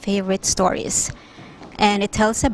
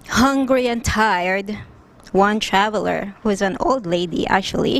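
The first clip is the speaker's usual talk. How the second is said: usual speaking voice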